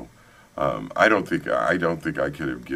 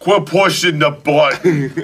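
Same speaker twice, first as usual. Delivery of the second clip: higher and louder, laughing through the corrected quote; laughing mock yell; 11 semitones higher, 10 dB louder, slower than usual. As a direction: yell it at the honking lady while cracking up. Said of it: "It was not".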